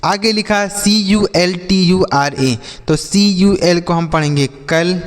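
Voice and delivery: normal talking voice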